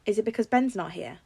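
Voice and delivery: ordinary delivery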